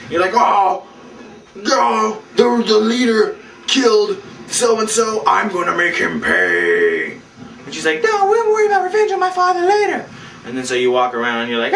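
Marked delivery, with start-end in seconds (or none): 0.3-7.2 mock heroic dismay. Acted reaction, vowing revenge. 8.0-10.1 very high squeaky girl impression; mock girl voice.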